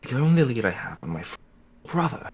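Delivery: ordinary delivery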